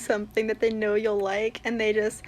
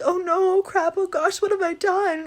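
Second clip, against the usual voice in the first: higher, louder flustered outburst; mock guilty panic; 6 semitones higher, 4 dB louder than usual. Character realising they accidentally upset someone, mock guilty panic. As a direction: act out a flustered "oops" moment.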